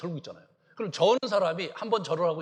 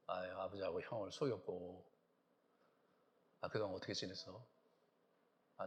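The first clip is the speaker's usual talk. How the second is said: put-on much softer, gentle plea to an older brother; tender apology; 11 semitones lower, 13 dB quieter than usual. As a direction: say sorry softly, heart open. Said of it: "그렇게 얘기해야 되잖아요".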